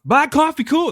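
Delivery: high, quick chirpy cut-in; eager barista. Retold or imitated. Barista eagerly repeats the order before he finishes. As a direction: snap it back like an eager barista.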